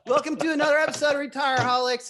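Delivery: fast hammed-up cowboy host; makeshift Texas accent. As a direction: rattle it off in a fake Texas twang. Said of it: "how long I'm gonna keep doing this accent".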